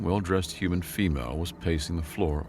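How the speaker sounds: ordinary delivery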